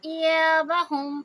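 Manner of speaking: ایابہم very slow, یا held two counts; Quran recitation. Shown as a demonstration. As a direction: stretch the یا in ایابہم. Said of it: "یا کو دو حرکہ". (ایابہم میں یا کو دو حرکہ لمبا کریں)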